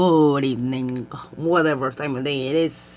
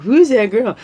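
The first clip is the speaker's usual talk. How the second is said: impressed; 7 semitones higher, 8 dB louder than usual. Retold or imitated. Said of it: "they're like".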